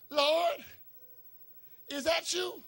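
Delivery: acted astonished hail; incredulous calling out. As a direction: call out as if unsure who is there. Peter calling across storm to Jesus.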